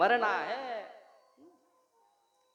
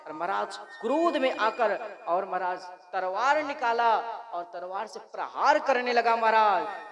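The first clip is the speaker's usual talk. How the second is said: ordinary delivery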